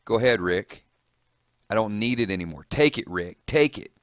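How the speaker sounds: usual speaking voice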